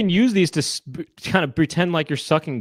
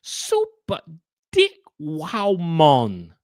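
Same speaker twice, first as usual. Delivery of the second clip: drawn-out imitated Jamaican accent; mock Jamaican accent; much slower than usual. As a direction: parody the ad in a Jamaican lilt. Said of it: "it sounds like Jamaican".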